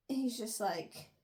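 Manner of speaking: ordinary delivery, nothing marked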